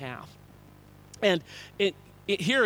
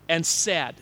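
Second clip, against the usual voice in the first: usual speaking voice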